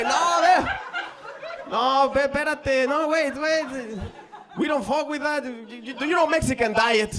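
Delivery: high, loud, stammering refusal; hammed-up panic, trying to stop her; panicked protest. Comedian dodging British girl's proposal, panicked and high.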